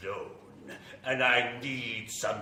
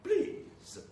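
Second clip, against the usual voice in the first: creepy welcome; 4 dB quieter than usual. Acted. Vampire greets guests at door.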